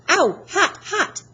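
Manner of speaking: high, loud pained yelps rising; yelping in pain. Acted. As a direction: hop about yelling at the burn. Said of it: "he yelled".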